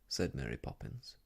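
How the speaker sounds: plain reading voice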